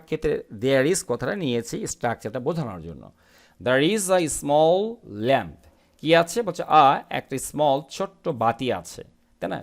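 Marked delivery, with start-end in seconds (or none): 3.6-5.7 each word read out slowly and clearly; slow model reading. Shown as a demonstration. Teacher reading the sentence slowly to students. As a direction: read it slowly for the class to follow. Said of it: "teacher reads model sentence slowly".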